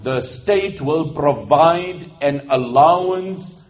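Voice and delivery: slow, weighty, spaced-out announcement; slow, firm ruler's declaration; solemn proclamation. Acted. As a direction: proclaim the decree word by word. Voices the caliph's proclamation.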